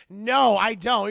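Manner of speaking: very loud, drawn-out acted no; shouted refusal. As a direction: shout it down slowly.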